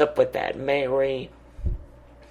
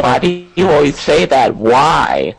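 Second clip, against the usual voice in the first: loud exasperated yell; 3 semitones higher, 10 dB louder than usual. Real, not acted. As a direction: shout it at the caller. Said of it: "doing a little bit of yelling".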